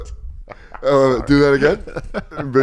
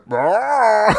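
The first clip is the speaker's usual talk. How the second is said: high, drawn-out mock roar; bear roar; 8 semitones higher, 3 dB louder than usual. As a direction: imitate a taunting bear. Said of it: "the bear's like".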